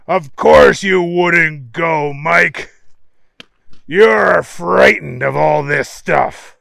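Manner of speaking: loud, slow, drawn-out taunt; scornful henchman. A narrator's reading. Marco mocking Mike for being frightened, loud scornful drawl. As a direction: stretch the words out and jeer.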